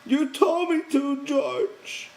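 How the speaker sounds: put-on high, childlike miserable plea. A narrator's reading. Lenny pleads to George after crushing the hand, miserable. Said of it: "he said miserably".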